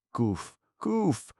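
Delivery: high shrieking summons; shrieking call. A narrator's reading. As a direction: shriek for the chamberlain. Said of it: "shrieked the Gnome King".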